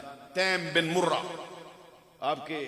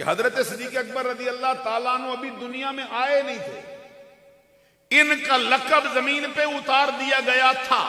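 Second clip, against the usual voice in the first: raised, ringing sermon voice; emphatic preaching; 6 semitones higher, 8 dB louder than usual. Acted. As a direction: drive the point home to the congregation.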